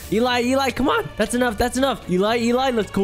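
ordinary talk, nothing marked

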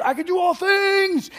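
slow, high, shouted "I can do all things"; big poster letters. Shown as a demonstration. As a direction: blast "I can do all things" like a giant gym banner. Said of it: "that's what it is".

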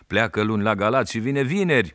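telling it with gusto; boy telling the policeman about his father, high and loud; excited boy. A narrator's reading. Boy explains, still calling.

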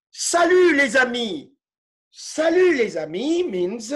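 ordinary delivery

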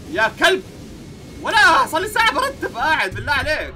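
2 high, loud angry rant; 3 yell it at the customer who wrecked an hour of work; 4 a shopkeeper raging at a customer who ruined his tidying; 1 furious yelling. Real, not acted.

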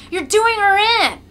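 high, loud cry of protest; alarmed shout. A narrator's reading. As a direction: shout to stop the cruelty. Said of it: "A third shouts".

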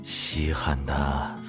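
low, very drawn-out admission; coaxing admission. Acted. Man wanting to be fed admits it, low and coaxing.

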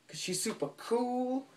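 playful high-pitched put-on voice; silly high voice. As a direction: send it way up high for a joke. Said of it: "I'll never do that again".

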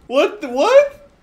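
loud, high rising yelp; incredulous shout. Real, not acted. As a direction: shout it as a shocked question. Baffled by the glitch.

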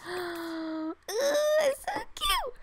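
high, drawn-out cooing squeal; gasp, then squeal slowly with delight. Real, not acted. Gamer gushing over her cute character, high squeal.

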